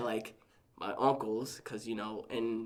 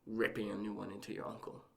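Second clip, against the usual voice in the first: hushed, softer quoted aunt; retold aside; 5 dB quieter than usual. Aunt pulls nephew aside to calm him, retold hushed aside. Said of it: "she pulled me to the side".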